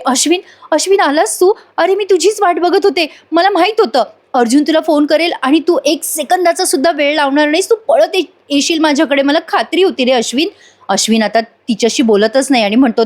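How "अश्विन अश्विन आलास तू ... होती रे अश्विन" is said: higher, eager heroine voice; Priya pleading. Acted. Priya in jail greeting Ashwin, high and eager. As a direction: gush with relief at him arriving. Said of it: "Priya त्याला बघितल्या बघितल्या म्हणते".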